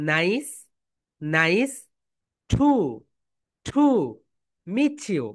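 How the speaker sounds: nice to meet you spaced out, meet you blended; slow drill. Shown as a demonstration. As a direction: model each word with long pauses. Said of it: "এখন বলো". (ধীরে ধীরে শব্দ আলাদা করে বলা)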